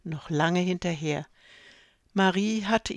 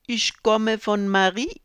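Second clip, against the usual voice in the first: put-on French accent; 4 semitones higher, 6 dB louder than usual. A narrator's reading. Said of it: "mit französischem Akzent".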